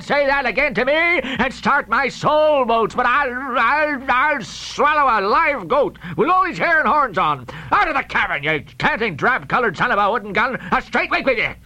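high, wailing outraged roar; explosive, blustering tirade with drawn-out cries; thundering fury. Acted. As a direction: rant as a mortally insulted sea captain. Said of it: "he thundered out this".

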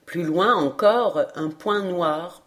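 ordinary delivery

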